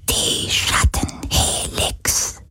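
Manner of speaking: slow, breathy ghostly whisper; knowing spirit whispering a name. A narrator's reading. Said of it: "flüsterte der Geist des Meeres".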